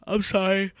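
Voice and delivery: talking through a yawn; words stretched by a yawn; mid-yawn. Real, not acted. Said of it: "Sorry, I yawned".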